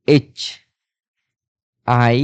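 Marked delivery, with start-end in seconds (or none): none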